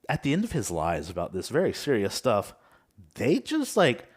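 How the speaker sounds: usual speaking voice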